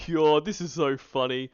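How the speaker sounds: ordinary delivery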